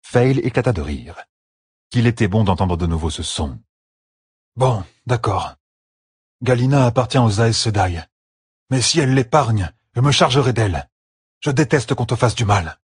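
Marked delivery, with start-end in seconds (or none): 4.6-12.8 slightly raised pitch, reluctant then resolute; lightly voiced Perrine, conceding. Perrine yields to his wife about Galina, reluctantly resolute.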